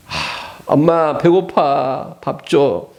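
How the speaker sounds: sighing, drawn-out mock whine to mom; slow, lightly whiny child act; hungry child pleading. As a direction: play a hungry kid begging for food.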